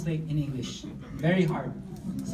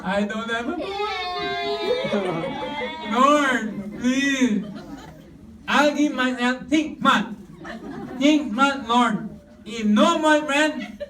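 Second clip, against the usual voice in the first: hammed-up cleft-palate nasal voice, slow, louder, pleading to the Lord; mock nasal crying prayer; 2 semitones higher, 3 dB louder, slower than usual. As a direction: play the nasal-voiced woman sobbing her prayer. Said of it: "ngongo siya". (pabirong ngongong pag-iyak na dasal)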